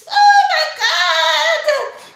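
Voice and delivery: very high, loud, drawn-out exclamation; excited squeal. Real, not acted. Vlogger dreaming of a spa day, squealing with delight.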